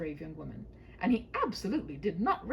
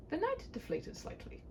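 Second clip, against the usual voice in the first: usual speaking voice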